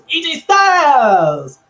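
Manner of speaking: high, loud, stretched-out name call; hammed-up wrestler's cry, very drawn out; Shinsuke Nakamura imitation. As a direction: sing out the name in a gleeful mock holler. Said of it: "Shinsuke comes in and he just goes".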